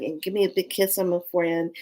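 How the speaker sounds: ordinary talk, nothing marked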